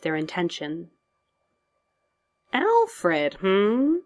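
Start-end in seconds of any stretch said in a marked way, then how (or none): 2.5-4.0 high, soft teasing query; soft-voiced Joker. A narrator's reading. Villain at hero's shoulder, soft teasing voice. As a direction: croon the name high, curling up into a hum. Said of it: "said a soft voice near his shoulder".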